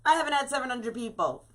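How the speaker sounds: gushing like an impressed friend; mock excited friend. Quoting her reaction to a crafter's big live audience, high and gushing. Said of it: "I was like".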